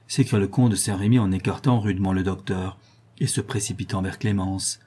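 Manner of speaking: usual speaking voice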